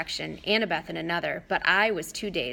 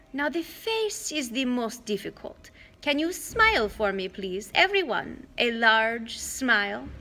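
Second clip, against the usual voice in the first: put-on sweet raised old lady voice, drawn out at the end; Auntie Em coaxing; 4 semitones higher than usual. Old aunt coaxing the kids to smile, sugary. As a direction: coax a smile like a doting aunt.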